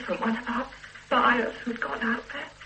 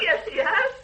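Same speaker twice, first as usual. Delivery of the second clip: high and loud, defensive; insistent protest; 8 semitones higher, 5 dB louder than usual. Acted. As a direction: fight back to defend him. Insists despite the detective's doubt.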